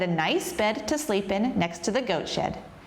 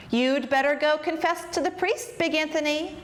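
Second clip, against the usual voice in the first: high, chiding singsong; mocking reproach; 6 semitones higher than usual. A narrator's reading. Townspeople tell Big Anthony off, mockingly. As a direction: scold him from on high.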